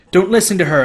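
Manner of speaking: loud warning yell; shouted. A narrator's reading. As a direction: shout from hiding among the statues. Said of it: "Annabeth's voice shouted".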